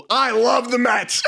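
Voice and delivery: slow, loud, high shout of a fan; mock shout. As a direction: belt it out like a proud Mets fan. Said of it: "the same guy goes".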